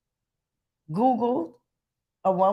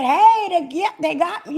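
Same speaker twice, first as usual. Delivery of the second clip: higher, louder calling out; imitated cry for attention; mock scream for help; 7 semitones higher, 5 dB louder than usual. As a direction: call out as a kidnap victim would.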